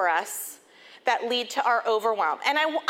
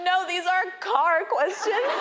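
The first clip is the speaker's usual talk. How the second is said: crying harder, imitated; 5 semitones higher, slower than usual. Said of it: "he starts to cry harder".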